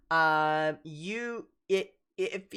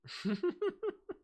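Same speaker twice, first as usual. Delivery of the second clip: high giggle; 3 dB quieter than usual. Acted. Cat laughs.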